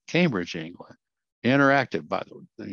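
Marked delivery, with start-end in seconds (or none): 1.4-2.1 Interactive punched higher and louder; stress on Interactive. Shown as a demonstration. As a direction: lean hard on Interactive to mark the key word. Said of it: "let me emphasize that word".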